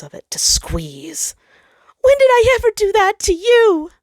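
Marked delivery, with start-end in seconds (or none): 2.0-3.9 high, loud demanding challenge; the sun retorting, high and loud; indignant retort. Acted. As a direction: throw the question back at him.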